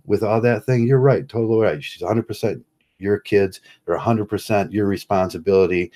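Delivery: normal talking voice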